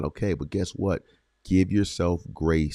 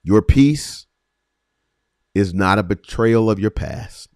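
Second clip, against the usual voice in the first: loud, raised cry of Your release; preaching swell; 6 dB louder than usual. Real, not acted. Preacher to listeners, loud proclaiming swell. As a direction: lift it out like a proclamation.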